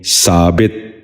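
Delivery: ثابت ending "-bit", short i; short i before the final t of ثابت; correct pronunciation. Shown as a demonstration. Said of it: "آپ بھی غور سے سنیے".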